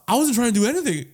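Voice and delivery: high-pitched defensive whine; retold protest. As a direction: plead innocence in a comic high voice. Defending himself to mocking barbers.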